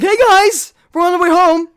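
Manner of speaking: high, bright streamer hello; livestream greeting. Retold or imitated.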